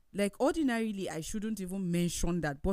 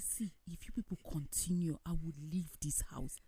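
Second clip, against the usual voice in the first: hushed threat; 2 semitones lower, 8 dB quieter than usual. Retold or imitated. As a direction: warn them under your breath, imitating yourself. Retold threat to leave home.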